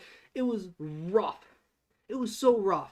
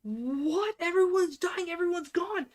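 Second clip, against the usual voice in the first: stunned outcry; 11 semitones higher, slower than usual. Retold or imitated. Fan reacts to every character dying, high panicked disbelief. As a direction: throw up your hands, everyone is dead. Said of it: "And I'm like".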